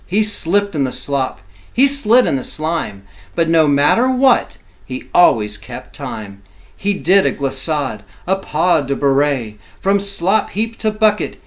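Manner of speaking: ordinary talk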